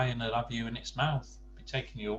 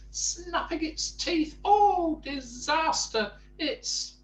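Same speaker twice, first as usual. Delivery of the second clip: high, loud, panicky wailing; panicked wailing; 4 dB louder than usual. Acted. Mister Jelly panics that a crocodile is in the bushes, high and wailing. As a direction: wail like the sky is falling.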